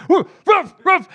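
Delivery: high, loud yappy mock barks; dog barking. [name] imitating his dog Harvey barking at the neighbour's dogs in high, loud yaps. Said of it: "and he's like".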